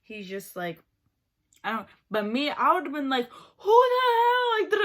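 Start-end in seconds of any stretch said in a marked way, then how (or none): 3.6-4.8 shrieked, drawn-out outraged yell, much higher and louder; mock outraged shout. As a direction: scream it out as if shocked by the prank. Imagined reaction to the prank.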